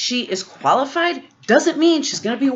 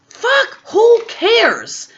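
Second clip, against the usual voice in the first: much higher, angry exclamation; exasperated outburst; 12 semitones higher, 4 dB louder, much slower than usual. Real, not acted. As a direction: blurt it out in disgust.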